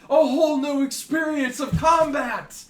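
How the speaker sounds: high, booming heroic shout; barbarian war cry. Acted. Barbarian charging into a tiny hole, shouting. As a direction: bellow it with grand relish. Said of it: "and he'll be like".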